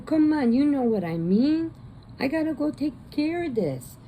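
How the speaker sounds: high, loud mock Italian-American mob boss; hammed-up Italian wiseguy accent; Italian mobster accent. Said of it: "He's got the Italian accent".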